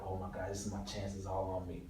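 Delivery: very soft, low worried murmur; anxious inner thought. Retold or imitated. Extra brooding over lunch, softly anxious. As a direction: think it to yourself, nervous. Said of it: "I'm just eating and thinking".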